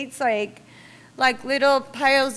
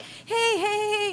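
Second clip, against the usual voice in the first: cheery greeting; 9 semitones higher, slower than usual. Retold or imitated. Friends greeting on the street.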